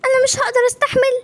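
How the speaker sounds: put-on high girl's voice near tears; tearful pleading. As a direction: push your brother away while crying. Infected sister pleads.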